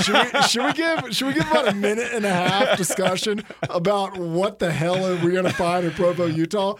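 laughing. Real, not acted. Host cracks up mid-question.

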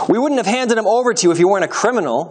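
high, indignant comeback; sharp retort. A narrator's reading. Accusers answer the governor about their prisoner, sharp retort. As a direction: answer back, affronted at the question. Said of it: "they retorted".

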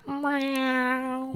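quiet drawn-out meow; soft cat meow. Acted. Cat sound.

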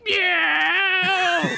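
high wailing cat scream; a big cat screaming far off; screaming meow. Acted. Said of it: "screaming from the distance".

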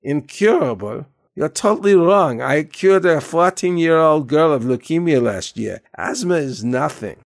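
put-on German accent. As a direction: imitate a brusque German lady. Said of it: "a lady with a thick German accent".

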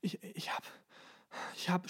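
halting, quiet stammer. A narrator's reading. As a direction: stumble over the first words, breaking off and restarting. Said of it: "stotterte er". (stockend gestottert)

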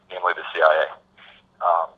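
normal talking voice

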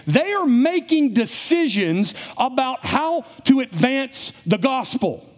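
high, slow, driving preacher delivery; emphatic preaching. Real, not acted. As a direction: preach it hard, stretching each phrase. Preaches at full force.

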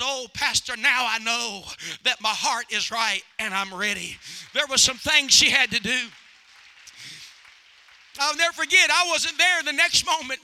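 loud, emphatic pulpit storytelling; rousing testimony preaching. Real, not acted. Preacher tells of a dying convert's assurance, rousing preaching.

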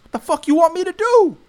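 high, loud exasperated yell; mock angry yell. Friend one yelling back at friend two, high exasperated shout. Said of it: "looks at friend number two and goes".

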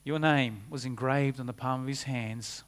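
ordinary talk, nothing marked

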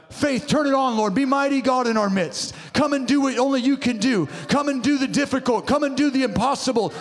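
high-pitched, insistent prayer in driving repeated phrases; fervent pulpit prayer. Real, not acted. Preacher asking God to come and act, fervently. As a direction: call out to God with rising urgency.

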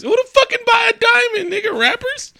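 loud, high, squealing disbelief; mock outraged yelling. Comic reacting to a silly headline, incredulous mock shriek.

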